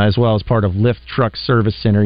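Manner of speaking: normal talking voice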